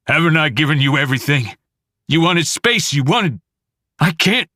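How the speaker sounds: a mild hurt, furious father; angry rant. Acted. Father ranting at his wife, fast and rising. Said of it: "father ranting at wife".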